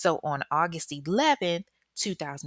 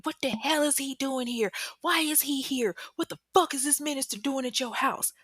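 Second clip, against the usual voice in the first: high-pitched acted rant, no real screaming; mock ranting; 9 semitones higher than usual. Jealous ex charges at the couple, mock ranting. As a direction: imitate an outraged man ranting. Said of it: "screaming and ranting".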